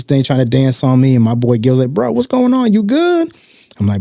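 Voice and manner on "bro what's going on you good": much higher, worried friend checking in; concerned friend. Retold or imitated. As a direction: pitch way up like an anxious buddy. Friend's concerned question quoted.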